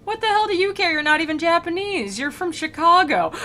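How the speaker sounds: high-pitched, exasperated challenge; incredulous retort. Acted. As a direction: call out the fan in disbelief. Retort in acted argument.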